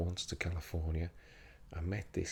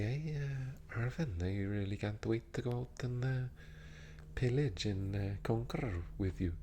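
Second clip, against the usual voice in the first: put-on lilting Norse raider with a Norwegian accent; 4 semitones higher than usual. Norseman eager to pillage with a comrade, Norwegian accent. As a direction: play a keen Viking in Norwegian-accented English. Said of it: "and they say".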